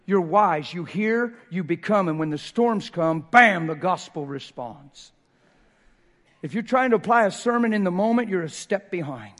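loud, rising preacher's call; preaching. Real, not acted. Pastor preaches to the church at full force. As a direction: drive it home to the congregation.

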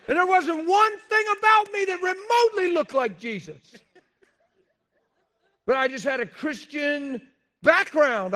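loud, high preaching shout; full-force preaching. Real, not acted. Pastor preaching hard to the church, loud and high. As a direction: drive it home to the congregation at full volume.

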